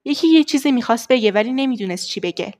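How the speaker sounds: rapid, clear diction drill; fast tongue twister. Shown as a demonstration. Teacher demonstrating a speed exercise for diction, fast tongue twister. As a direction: rattle it off quickly in one go. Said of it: "اینا رو سعی کن خیلی تون تون بیان بکنی".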